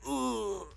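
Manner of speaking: high, loud pained grunt; shot man's grunt. Acted. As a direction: grunt as a bullet hits. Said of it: "you do hear one of them go like".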